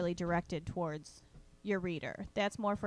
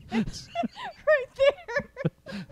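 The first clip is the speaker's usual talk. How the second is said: 1 laughing; 9 dB louder, much slower than usual. Real, not acted. Cracking up over the pitch.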